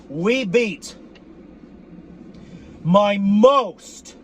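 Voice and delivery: final words slow and heavy; building shout. Real, not acted. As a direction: slam each word harder as it builds. Building rant about rival.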